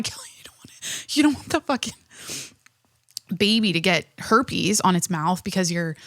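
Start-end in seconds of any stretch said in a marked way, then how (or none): 0.0-1.9 breathy words squeezed through real laughter; laughing while talking. Real, not acted. Host cracks up at her own herpes warning, laughing. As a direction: crack up mid-sentence, losing your voice to laughter.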